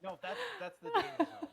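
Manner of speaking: high squealing laugh through words. Real, not acted.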